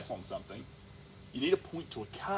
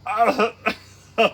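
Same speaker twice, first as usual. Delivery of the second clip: mock stammering groan, rising loud; hammed-up floundering noises; flustered dealer; 6 semitones higher, 10 dB louder, much slower than usual. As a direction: stall helplessly as a stumped salesman. Poor dealer lost for an answer.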